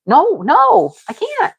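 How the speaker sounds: squeaky high, half-laughing no; high-pitched fed-up denial; exasperated refusal. Retold or imitated. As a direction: protest that you simply can't.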